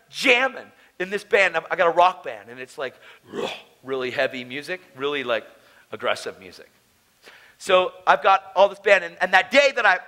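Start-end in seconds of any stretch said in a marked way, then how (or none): none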